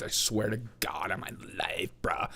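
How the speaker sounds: unvoiced mock whisper of a sworn claim. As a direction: breathe it like a secret oath. Mocking a lefty's sworn claim.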